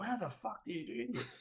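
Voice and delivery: high, bewildered squeak of disbelief; baffled new gamer. Retold or imitated. New player fumbling his first game, high and baffled. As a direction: sound totally lost on the controller.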